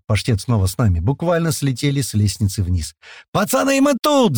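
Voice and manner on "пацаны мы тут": very high-pitched yell. A narrator's reading. Men waving to their friends, yelling. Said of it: "заорали мы".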